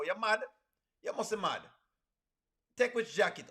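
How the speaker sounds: ordinary delivery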